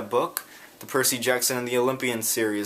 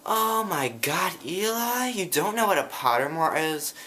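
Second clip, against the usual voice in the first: aghast fan; 8 semitones higher than usual. Retold or imitated. Girl on the bus scolding Eli, high and aghast. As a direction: sound scandalised that he doesn't know.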